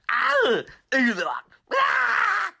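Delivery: short, loud high-pitched shrieks; dying asteroids' screams. Acted. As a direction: shriek as you get blasted. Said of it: "the asteroids like".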